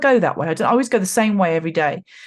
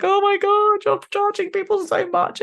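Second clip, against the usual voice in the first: much higher, squealing disbelief at the prices; mock outrage; 14 semitones higher than usual. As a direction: shriek it like you can't believe the price tag. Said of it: "I'm like".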